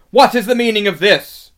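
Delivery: loud, high-pitched indignant witch; outraged demand. A narrator's reading. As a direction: demand an explanation at full voice. Witch confronts terrified party.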